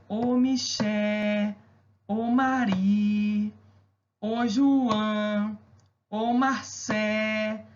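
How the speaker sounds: slow singsong calling of names; Salvador street call. Shown as a demonstration. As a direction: call each name the Bahian way, stretching the stressed syllable. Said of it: "você demora mais naquela palavra".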